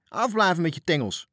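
high, loud angry bellow; furious roar. A narrator's reading. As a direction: snarl a wild warning, hands off. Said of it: "begon woest te brullen".

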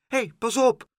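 high, loud, scared little squeak of a voice; frightened cry. A narrator's reading. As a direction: cry out in alarm from below. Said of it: "een stemmetje dat angstig riep".

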